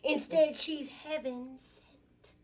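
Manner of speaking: high, loud spoken-word flourish; sassy poem declamation. Acted. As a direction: proclaim it with sass, drawing out the ending.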